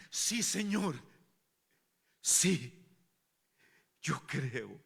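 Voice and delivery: breathless answer in broken pieces; agitated, emotional. Acted. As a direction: gasp it out with a pounding heart. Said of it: "un corazón agitado, el corazón latiendo emocionado". (agitado y emocionado, sin aliento)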